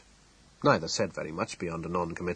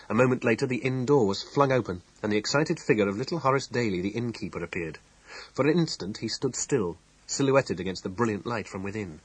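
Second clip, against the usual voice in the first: usual speaking voice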